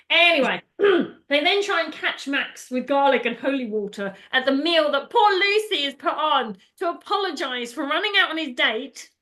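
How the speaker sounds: ordinary talk, nothing marked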